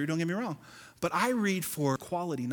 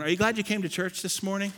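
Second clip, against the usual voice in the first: ordinary talk, nothing marked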